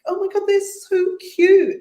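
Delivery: high and loud, gushing over how cute they are; gushing delight. Retold or imitated. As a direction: coo like meeting a puppy. Retold gushing over cousins' accent.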